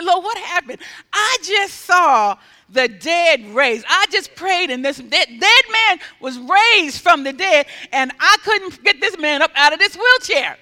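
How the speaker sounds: loud, baffled complaint to God; exasperated plea. Retold or imitated. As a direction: cry out in acted disbelief.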